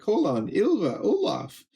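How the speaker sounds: high-pitched imitation of kids calling. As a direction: call out in a high mock child's voice. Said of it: "the kids are like".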